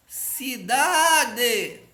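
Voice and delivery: ci-DAAA-de, chamado alto e agudo; como se chamasse. Shown as a demonstration. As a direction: chame Cidade demorando no DA. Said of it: "parece que eu estou chamando". (Cidade como chamado, DA esticado)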